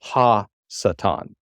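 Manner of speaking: Ha Satan slowly, as hah sah-TAHN; Hebrew pronunciation. Shown as a demonstration. Teacher cites Hebrew Bible, Ha Satan slowly as hah sah-TAHN. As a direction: give Ha Satan in Hebrew, slow and spaced. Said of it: "everywhere in the Hebrew Bible".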